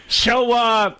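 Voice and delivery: squawky hesitation before a joke; Gilbert Gottfried. Acted. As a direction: stretch it out in a comic impression. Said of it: "the final joke was he said".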